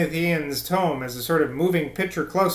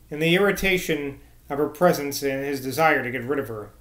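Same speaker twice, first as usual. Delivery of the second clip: usual speaking voice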